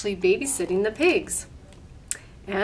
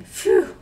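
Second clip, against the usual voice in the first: unvoiced breathy phew; relieved phew; 7 dB louder than usual. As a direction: blow out in relief. Relief after frog scene.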